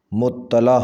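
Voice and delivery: مطلع with doubled t, "muttala"; correct pronunciation. Shown as a demonstration. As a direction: say مطلع as mut-ta-la. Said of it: "صحیح لفظ ہے".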